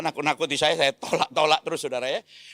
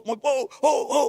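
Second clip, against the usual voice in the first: high put-on crowd cry of "Ho"; mock crowd shout; 6 semitones higher, slower than usual. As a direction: call out like an angry crowd. Crowd shouting after car crash.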